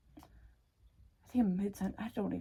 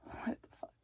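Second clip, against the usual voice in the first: breathy whispered disbelief; whispered shock; 12 dB quieter than usual. Retold or imitated. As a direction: whisper it, stunned. Retelling inner shock.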